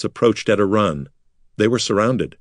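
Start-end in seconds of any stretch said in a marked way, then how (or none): none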